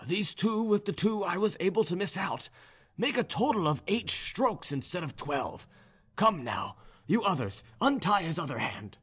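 high serf voice, easing down into orders; Ivan, serf character. A narrator's reading. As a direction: start high, then direct the others.

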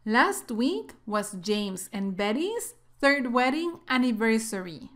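slow, clear model reading; careful teacher model sentence; slow read-aloud. Shown as a demonstration. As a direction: read it slowly for students to repeat. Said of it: "en voz alta".

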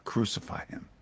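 hushed breathy crucify him, said under the breath; soft unvoiced crowd demand; shouted crowd cry. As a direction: let the mob's cry land in a hush. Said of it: "But they shouted all the more".

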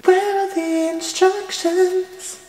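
very high, slow sing-song; sing-song mock search. Vlogger hunting for instructions, high sing-song. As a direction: float it up high and drawn out like a little tune.

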